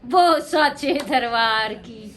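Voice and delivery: very slow, low intoned call; low, long-held devotional refrain; devotional slogan call. Real, not acted. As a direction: stretch out every word of the slogan. Host calls out devotional slogan.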